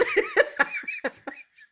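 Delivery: high, loud burst of laughter. Real, not acted. Speaker cracking up after her impression, high laughter. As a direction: cackle high and free.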